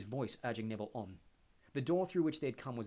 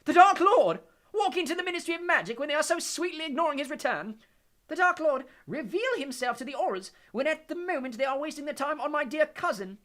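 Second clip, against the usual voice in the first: shrieking Bellatrix; 13 dB louder than usual. A narrator's reading. Bellatrix mocking Harry's question, shrill shriek. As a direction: shriek it back at him in mad disbelief. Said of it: "shrieked Bellatrix on a cackle".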